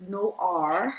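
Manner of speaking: "Noir" drawn out slowly in a Texas accent; Southern-accented "Noir", stretched. Real, not acted. Host naming a guest's business, Texas accent on "Noir". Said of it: "me and my Texas accent".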